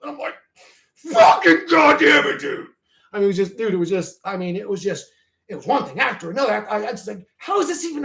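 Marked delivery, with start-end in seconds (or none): 1.0-2.7 much higher, louder, slow angry yell; furious retold shout. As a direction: yell it out at the spilled detergent puddle. Retold outburst at spilled detergent.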